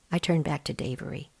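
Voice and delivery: ordinary delivery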